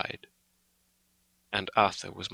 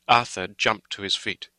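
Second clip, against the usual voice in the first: plain reading voice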